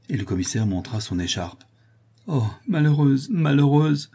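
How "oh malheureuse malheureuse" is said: high, louder wail of despair; despairing lament, pitched up; anguished cry. A narrator's reading. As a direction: cry out in misery as if collapsing. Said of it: "s'écria Louise en tombant agenouillée".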